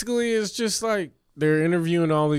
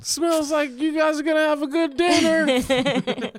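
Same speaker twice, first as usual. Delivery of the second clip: shouting; 14 semitones higher than usual. Pretend. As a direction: yell it from the car. Shouted from passing car.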